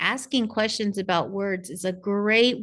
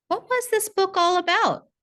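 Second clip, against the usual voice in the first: put-on much higher, bright and eager, asking a child about a book; upbeat parent question; 11 semitones higher, 3 dB louder than usual. Shown as a demonstration. Parent asking child about the book, eager high questioning voice. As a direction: pitch it up high like coaxing a young reader to talk. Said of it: "Ask questions about what happens in a book".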